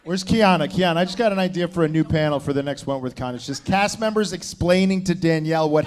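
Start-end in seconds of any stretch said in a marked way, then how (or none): none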